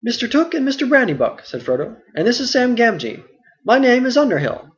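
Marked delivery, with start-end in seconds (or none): 0.0-1.5 put-on a lightly higher, puzzled young hobbit; Frodo, mildly questioning; Frodo's voice. Frodo gives false names. 2.1-4.7 put-on a lightly higher, puzzled young hobbit; Frodo, mildly questioning; Frodo's voice. Frodo continues.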